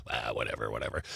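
low, soft mock brush-off; dismissive. Acts out a dismissive reply.

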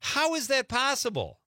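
high-pitched disbelief; incredulous. Real, not acted. Host reacting to a breath-holding record, incredulous. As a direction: marvel at an unbelievable feat.

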